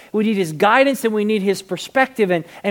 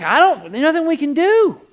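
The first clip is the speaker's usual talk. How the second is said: high-pitched mock helplessness; helpless protest; 10 semitones higher, 5 dB louder than usual. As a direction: protest that it's out of your hands. Acting the helpless disciples.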